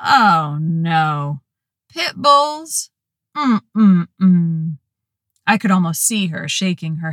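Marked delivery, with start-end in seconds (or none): 0.1-4.8 deep resonant drawl, tutting; disapproving drawl. A narrator's reading. Dispatcher disapproving of pit bulls, deep drawl. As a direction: drip with disapproval, shake your head. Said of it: "deep, resonant drawl that dripped with disapproval".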